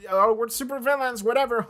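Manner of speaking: mock wannabe villains playing around; mock super villains. Nerdy friends playing at villainy, imitated gleeful boasting. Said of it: "chuny shit".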